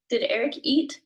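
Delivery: English yes-no question, lift the pitch on "eat"; rising question tune. Shown as a demonstration. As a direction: voice going up on "eat". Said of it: "you hear my voice go up".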